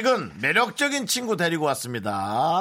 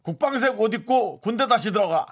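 parody news anchor; 7 semitones higher, 5 dB louder than usual. Shown as a demonstration. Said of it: "제가 먼저 할게요".

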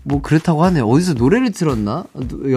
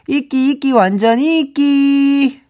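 high, drawn-out teasing sing-song; playful teasing; 11 semitones higher, 4 dB louder, slower than usual. Pretend. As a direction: tease the listener cheerfully.

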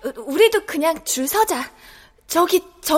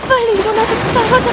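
fast, louder and higher, rushing; frightened child urging a friend outside fast; urgent hurry; 6 semitones higher, 7 dB louder, faster than usual. Acted. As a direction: hurry them out before it's too late.

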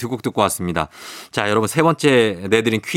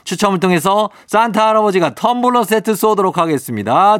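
hyped giveaway announcement; 6 semitones higher than usual. Acted. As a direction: hype up the prize giveaway. Prize announcement.

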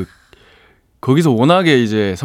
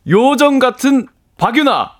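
much higher proclaimed acrostic line; acrostic recital; 12 semitones higher than usual. Pretend. Guest completing the acrostic for the host, high proclaimed recital.

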